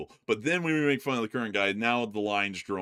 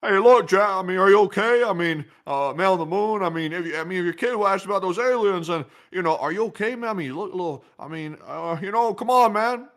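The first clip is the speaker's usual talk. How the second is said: higher, louder put-on politician voice; raised comic impression voice; 8 semitones higher, 6 dB louder, faster than usual. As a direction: lay on a mocking politician's voice, pressing Jack. Impression bit addressing Jack.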